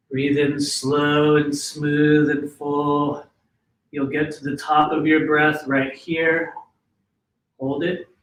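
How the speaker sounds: slow, smooth breathing instructions; guided breathing. Shown as a demonstration. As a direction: draw it out like the breath itself. Demonstrates the breath.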